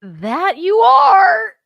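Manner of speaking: high, loud, slow crying shout; mock wail. Daughter mimics the stage reply, laughing mock wail.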